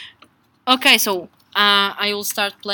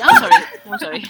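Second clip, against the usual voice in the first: laughing; 5 dB louder, much faster than usual. Real, not acted. Laughing apology after hitting.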